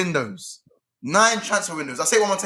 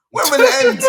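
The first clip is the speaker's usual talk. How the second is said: laughing despair; 6 dB louder than usual. Real, not acted. Despairing football fan laughing.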